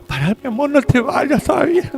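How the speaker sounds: husband begging his wife not to leave, half joking; pleading husband. Retold or imitated. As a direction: beg your wife playfully to stay.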